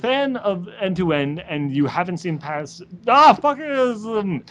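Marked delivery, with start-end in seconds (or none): 3.1-4.4 frustrated outburst. Real, not acted. Frustrated at own slip.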